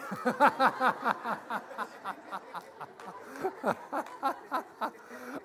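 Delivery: big open laugh, rising; loud burst of laughter. Real, not acted. Pastor laughing at the toothless old lady's line, loud laugh.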